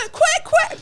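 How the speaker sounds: high shouted duck quacks. Retold or imitated. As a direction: shout quack quack in her face. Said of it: "this woman shouting in your face".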